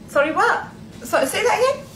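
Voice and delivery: ordinary talk, nothing marked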